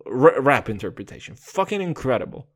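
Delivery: ordinary talk, nothing marked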